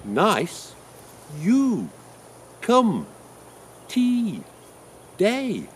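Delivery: "Nice, you, come, tea, day" high and loud with falling swoops; second syllables stressed. Shown as a demonstration. Teacher shows the meaningful second syllables, each stressed high and loud. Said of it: "If you stress the second syllable".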